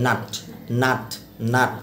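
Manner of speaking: "নাট" with a short, clearly sounded a. Shown as a demonstration. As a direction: say "নাট" briefly, vowel fully pronounced. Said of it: "এখানে উচ্চারণটা হবে".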